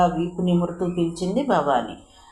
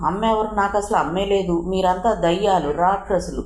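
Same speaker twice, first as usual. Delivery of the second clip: ordinary delivery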